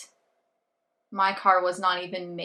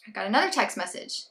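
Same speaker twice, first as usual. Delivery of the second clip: ordinary delivery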